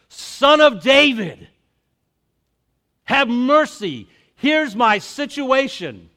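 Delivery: loud, slow desperate plea; pleading cry. Acted. Canaanite woman crying out to Jesus, loud and pleading. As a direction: cry out to him for mercy. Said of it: "and she declares".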